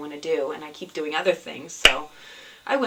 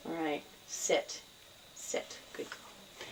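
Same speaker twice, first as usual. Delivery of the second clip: soft command to cat; 2 semitones lower, 13 dB quieter, slower than usual. Real, not acted. Host murmurs to her cat to sit, slow and hushed. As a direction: settle the cat gently and quietly.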